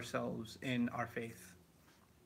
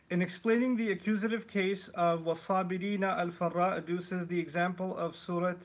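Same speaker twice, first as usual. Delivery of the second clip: formal read-aloud delivery, louder and higher; reading aloud from a book; 4 semitones higher, 4 dB louder than usual. Shown as a demonstration. Speaker demonstrating how reading a book sounds. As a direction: read the scholarly text like a lecture recitation. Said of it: "Let me demonstrate".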